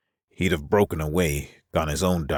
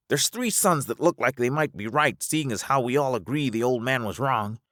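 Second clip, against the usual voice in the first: high, earnest character voice; high-voiced Alvar, reasoning; 10 semitones higher than usual. Alvar persuading them to split up, high earnest voice. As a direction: make the case in a high register.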